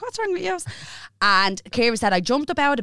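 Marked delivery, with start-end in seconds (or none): 0.0-0.6 very high incredulous question; indignant. Retold or imitated. [name] imitating herself confronting staring friends, very high. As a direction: snap back puzzled. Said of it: "And I was like".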